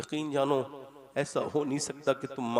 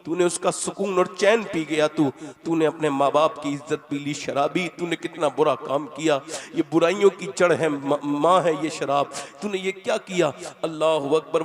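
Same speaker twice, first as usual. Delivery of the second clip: put-on loud, rising rebuke of the drunkard; sermon lament at full force; fiery preaching; 3 semitones higher, 5 dB louder than usual. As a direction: hammer each line at the sinner.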